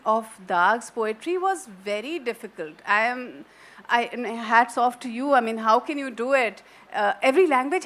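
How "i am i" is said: usual speaking voice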